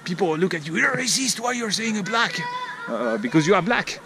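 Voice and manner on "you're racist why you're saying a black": higher-pitched offended passer-by; mock accusation. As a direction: act out taking offence.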